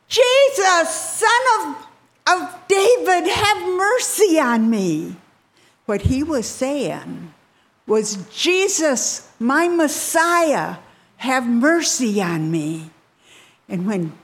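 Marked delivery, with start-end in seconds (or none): 0.1-5.2 loud, very high desperate yell; yelling plea. Retold or imitated. Blind man shouting over those hushing him, pleading. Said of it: "he started to yell". 8.3-12.9 high, slow calling out, easing down; fervent plea. Retold or imitated. Blind man calling Jesus his Messiah, fervently pleading.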